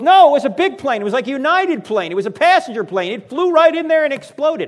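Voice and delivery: high, loud, frantic telling; alarmed excitement. Retold or imitated. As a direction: blurt it out in shock. Pastor frantically describing plane crash.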